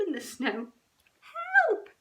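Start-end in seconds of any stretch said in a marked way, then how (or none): 1.2-1.9 high, loud little squeak; a tiny cub's frightened squeak. A narrator's reading. Little cub calling for help from the hole, high squeak. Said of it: "he squeaked".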